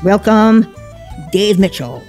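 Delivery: ordinary talk, nothing marked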